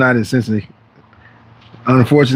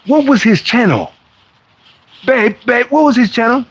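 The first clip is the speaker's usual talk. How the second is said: mock forgetful viewer, pitched higher, calling to his partner; a viewer who forgot; 8 semitones higher than usual. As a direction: act out a lost fan asking his babe for the channel name. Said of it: "you go".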